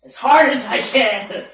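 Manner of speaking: loud, high eager shout. Real, not acted. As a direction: shout it with glee.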